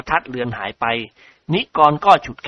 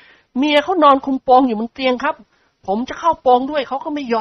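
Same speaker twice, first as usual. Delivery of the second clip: put-on high jokey young man; Nikorn laughing; 10 semitones higher than usual. A narrator's reading. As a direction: make light of it, chuckling. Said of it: "ด้วยเสียงหัวเราะ". (นิกรพูดกับพ่อตาพลางหัวเราะ)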